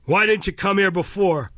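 high, loud, testy question; Mister Fang, accusing. A narrator's reading. Magistrate faulting a witness for coming late, accusing.

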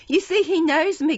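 high, loud appeal; appealing cry. A narrator's reading. Nancy appealing to bystanders, high loud cry. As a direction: cry out to win the crowd over. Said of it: "cried Nancy, appealing to the bystanders".

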